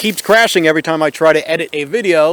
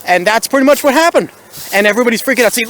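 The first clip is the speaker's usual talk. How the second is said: usual speaking voice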